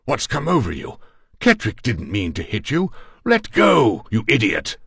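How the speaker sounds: high alarmed protest building to a shout; loud, high-pitched frantic appeal; urgent exclamation. A narrator's reading. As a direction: shout to break up a fight. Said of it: "exclaimed Conrad, seeking to break my grip".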